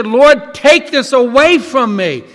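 loud, high pleading cry to God; desperate plea. Retold or imitated. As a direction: beg the Lord loudly to take it away. Pastor voices a desperate prayer.

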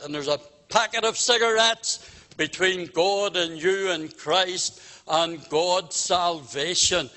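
ordinary delivery

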